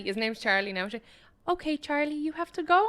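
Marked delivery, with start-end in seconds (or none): 1.5-2.9 put-on tearful little girl. Little girl lets dog go.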